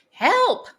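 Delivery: loud, high call of "Help"; in unison. A narrator's reading. Ant triplets asking Big Benny: loud unison cry. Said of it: "they say in unison".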